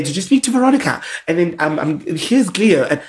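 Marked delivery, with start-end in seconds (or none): 0.0-1.0 high, loud, fast mock nagging; imitating nagging friend. 2.0-2.8 higher, louder mock pushing; imitating pushy friend. Imitating friend's daily nagging.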